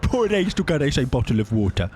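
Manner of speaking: hammed-up British "Bottle of water"; mock British accent. Shown as a demonstration. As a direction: say "Bottle of water" with dropped t's, high and plummy. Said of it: "Any words you'd like to say?".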